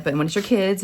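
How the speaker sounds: ordinary talk, nothing marked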